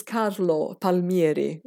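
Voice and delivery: Carlo Palmieri said the Italian way, rolled r and open vowels, slow and deliberate; Italian pronunciation. Shown as a demonstration. Guest correcting herself on the oncologist's name, saying it the Italian way. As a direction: pronounce Carlo Palmieri as an Italian would, taking care over each syllable. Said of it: "My, my parents are Italian".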